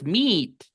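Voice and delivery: "meet" with a long, stretched English EE. Shown as a demonstration. Teacher confirming double E spelling, long I. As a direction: draw out the vowel of "meet". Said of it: "¿Con I larga?".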